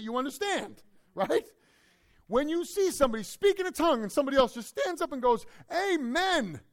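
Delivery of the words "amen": high, loud mock amen; high-pitched churchgoer's outburst; acted congregant's amen. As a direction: call out an overeager amen, imitating. Acts out congregant standing up to amen.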